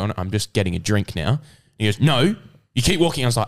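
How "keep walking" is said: higher-pitched teacher's order; mild retold yell; mock yelling teacher. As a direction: imitate a teacher moving a student along. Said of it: "he was, like, yelling".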